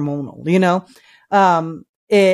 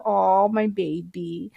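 cooing; 2 semitones lower, 6 dB quieter, slower than usual. Real, not acted. Cooing at her dog in background.

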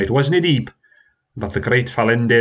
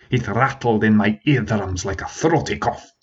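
ordinary delivery, nothing marked

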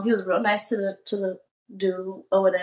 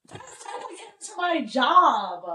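excited gasp; 6 semitones higher, slower than usual. Real, not acted. Excited [name] reveal.

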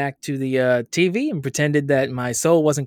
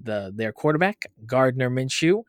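ordinary talk, nothing marked

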